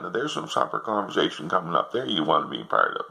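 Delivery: ordinary talk, nothing marked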